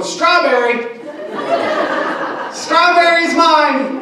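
high, loud, drawn-out shout of strawberry; mock battle cry. Student shouting fruit at sword instructor, mock battle cry. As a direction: yell it like a war cry in sword class. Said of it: "I was standing there going".

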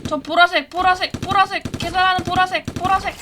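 loud high calling out; excited calling out. Real, not acted. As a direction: call it out eagerly. Calling out the purple player. (흥분한 외침)

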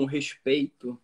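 ordinary talk, nothing marked

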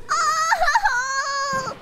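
high, long wailing "oh"; drawn-out melting coo; cooing squeal. Real, not acted. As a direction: squeal as if overcome by cuteness. Melting over cute cat.

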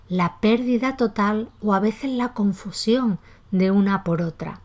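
ordinary talk, nothing marked

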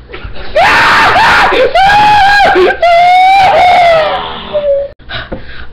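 long, high, shrieking evil cackle; villain laugh. Acted. Baby robber's evil laugh.

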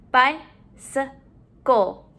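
"Bicycle" slowly, in three beats; syllable by syllable. Shown as a demonstration. Teacher modeling syllables to put together, broken up. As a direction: pause between each syllable of "Bicycle". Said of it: "let's put our syllables together".

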